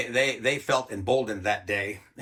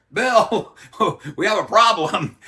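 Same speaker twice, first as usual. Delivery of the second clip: higher, louder holler with a laugh; calling out; 8 semitones higher, 8 dB louder, slower than usual. Retold or imitated. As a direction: call downstairs, laughing. Photographer hollers down the stairs.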